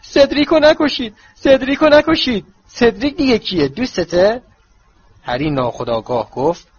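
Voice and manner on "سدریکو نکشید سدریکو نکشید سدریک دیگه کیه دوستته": high, loud mocking imitation of Harry crying out; mimicking a frightened dreamer in a high, loud, shrill-free mock wail; mock nightmare cries. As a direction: ham up Harry's nightmare pleas, much higher and louder. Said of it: "با صدای بلند گفت".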